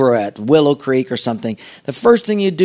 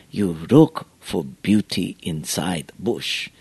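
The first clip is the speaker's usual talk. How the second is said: Mister Miyagi; 5 semitones higher than usual. Retold or imitated. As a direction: teach like an old bonsai master, drawing out key words. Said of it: "when he's talking like this".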